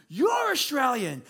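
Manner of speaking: slow, high imitated exclamation; delighted recognition. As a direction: stretch it out as the stranger's delighted reply. Stranger echoes the recognition back.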